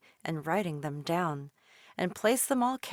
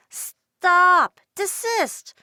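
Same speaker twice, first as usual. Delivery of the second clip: much higher, loud desperate cry; 11 semitones higher, 11 dB louder, much slower than usual. A narrator's reading. Dolores crying after the pelican, high and loud. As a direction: shout after the fleeing bird. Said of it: "Dolores cried out and wailed".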